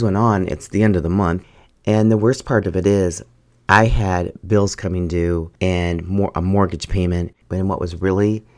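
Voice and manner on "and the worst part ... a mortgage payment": normal talking voice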